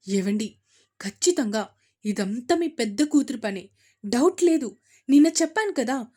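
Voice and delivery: loud, bitter tirade against the daughter; fuming anger. A narrator's reading. Wife rails at husband about their elder daughter, fuming loudly. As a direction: spit the words out like fire. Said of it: "మిథున మీద నిప్పులు కక్కుతూ".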